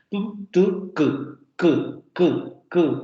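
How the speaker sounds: "Tu" and "ku" burst out; "Tu, tu, ku" as crisp plosives; demoed stops. Shown as a demonstration. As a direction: pop each "ku" from the back.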